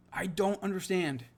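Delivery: ordinary talk, nothing marked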